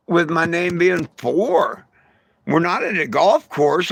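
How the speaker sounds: usual speaking voice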